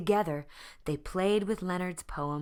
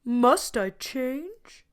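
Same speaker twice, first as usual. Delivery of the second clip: doubtful lion; 9 semitones higher, 8 dB louder, much slower than usual. A narrator's reading. Leonard doubting himself.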